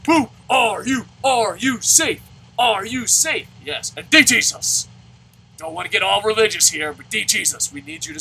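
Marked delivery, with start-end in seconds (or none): 0.0-4.9 loud, high, excited yelling at the screen; excited cheering. Real, not acted. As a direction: cheer your runner home, all-out. Cheering at the baseball game.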